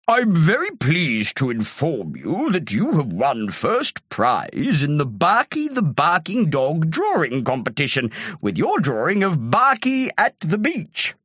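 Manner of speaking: ceremonious chauffeur delivering news; formal chauffeur. A narrator's reading. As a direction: announce it grandly, drawing out the words. Chauffeur announces Terry's prize.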